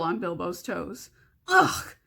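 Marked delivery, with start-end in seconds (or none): 1.5-1.9 high, disgusted creature voice; disgusted creature grunt. Acted. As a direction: spit out the ugh like a slimy creature. Gollum voice in read story.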